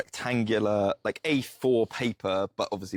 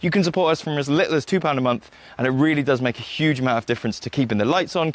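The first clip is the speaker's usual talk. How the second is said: louder, higher, upbeat promo read; podcast support appeal; 4 semitones higher, 5 dB louder than usual. Real, not acted. Host asking listeners to support on Patreon, upbeat announcer read. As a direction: pitch it brightly to the listeners.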